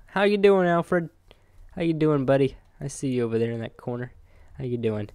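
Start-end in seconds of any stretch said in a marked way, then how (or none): 0.1-2.6 high, louder friendly pet voice; pet talk greeting. Real, not acted. Gamer greeting his pet Alfred, high and louder. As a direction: coo a hello to the pet.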